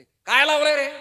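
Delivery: loud, high rebuke; stern shout. Acted. Krishna scolding Arjuna loudly. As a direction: snap at him sharply.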